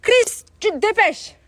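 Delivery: high, loud mock Congolese accent. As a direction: hurry him up with a hammed-up Congolese accent. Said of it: "C'est l'accent congolais".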